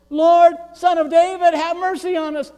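high, full-voiced desperate shout; beggars' loud plea, pitched high. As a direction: cry out over the crowd's objections. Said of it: "they only shouted louder".

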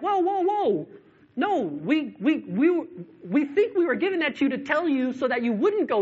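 hammed-up panic, trying to stop him; alarmed mock church voice. Church alarmed Paul still goes.

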